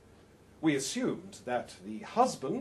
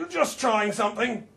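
higher and louder, offhand; Harry's voice; 6 semitones higher, 7 dB louder than usual. Acted. Harry excusing his trump to his partner, offhand and loud. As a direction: shrug it off as an experiment. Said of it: "says Harry".

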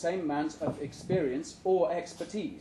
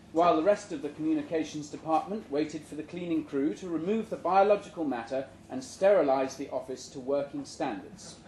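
plain reading voice

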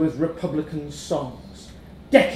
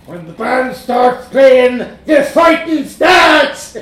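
loud, slow, high gleeful yell; laughing monster shout; 9 semitones higher, 12 dB louder, slower than usual. A narrator's reading. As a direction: bellow it with a laugh.

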